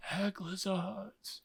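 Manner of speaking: faint, slow dying breath of a name; dying whisper. Retold or imitated. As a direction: let the name fade out as he dies, slow and soft. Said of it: "he dies just whispering".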